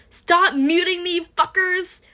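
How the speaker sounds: fed-up yell at the mods; angry shout. Acted. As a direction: yell at them to stop muting. Muted user protests in chat.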